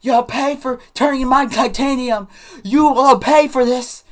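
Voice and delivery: put-on high, loud vengeful kid's wail; mock screamed threat. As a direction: yell revenge like a boy being dragged away. Dragged boy screaming threats at narrator.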